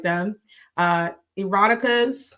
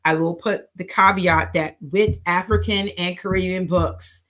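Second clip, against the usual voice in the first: usual speaking voice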